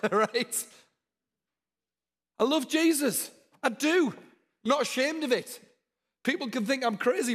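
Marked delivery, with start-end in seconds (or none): 2.4-7.3 loud and much higher, full of passion; fervent declaration. Real, not acted. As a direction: proclaim it boldly to the crowd.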